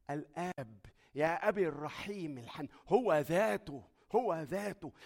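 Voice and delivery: loud, impassioned sermon build; preaching fervently. Acted. Preacher telling the congregation the judge is the Father, loud and fervent. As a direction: proclaim it like a pulpit climax.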